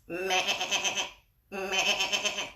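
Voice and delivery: bleating like a goat; playful goat impression for the kids. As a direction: give a silly goat "meh". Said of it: "That's my best goat impression".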